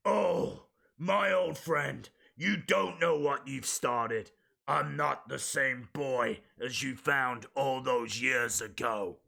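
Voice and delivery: lightly voiced wrestler promo; Braun Strowman voice. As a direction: growl it out like a brooding giant. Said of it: "I'll do it in his voice".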